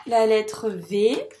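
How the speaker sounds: "V" held long and slow; stress on V. Shown as a demonstration. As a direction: lean on "la lettre V" slowly. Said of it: "fait bien le son V".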